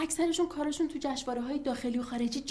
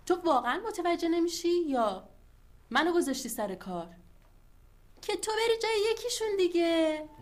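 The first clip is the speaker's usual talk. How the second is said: exasperated; 5 semitones higher, 6 dB louder than usual. Acted. Exasperated wife pushes husband.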